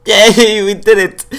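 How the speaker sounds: loud, high victory cheer; triumphant cheer. Real, not acted. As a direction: burst out cheering at the win. Wins the rodeo after long silence.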